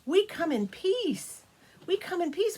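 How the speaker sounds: high, slow and careful; small child offering peace; childlike high voice. Acted. Children calling up to the dragon, high and gentle.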